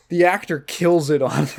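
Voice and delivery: ordinary talk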